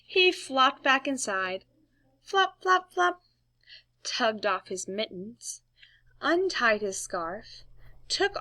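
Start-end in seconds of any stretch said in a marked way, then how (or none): none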